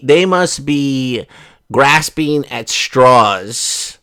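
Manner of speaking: drawn-out, each word weighted; slow it right down for effect; deliberately slow delivery; slow emphatic. Real, not acted. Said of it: "slowed emphasis".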